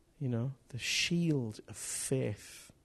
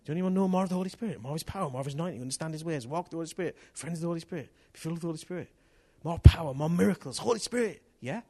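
higher, louder, quick rallying preaching; building fervour of a preacher's call; fervent appeal; 6 semitones higher, 9 dB louder, faster than usual. Real, not acted. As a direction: stir the room up, pressing each phrase.

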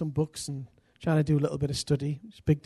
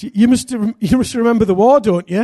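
loud, higher, stumbling mock eagerness; over-eager blurt; 5 semitones higher, 9 dB louder than usual. Preacher forcing war talk on an old man, over-eager. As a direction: re-enact blurting it out far too keenly.

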